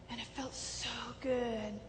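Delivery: slow, whispered with longing; breathy whisper. Real, not acted. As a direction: let it slip out as a hushed memory. Stranger's comforting arm.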